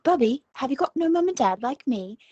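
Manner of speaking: hammed-up accent of an orphaned TV boy; put-on child character accent. Entertainer recalling a Disney show's little guy, put-on accent. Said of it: "I used to love doing accents".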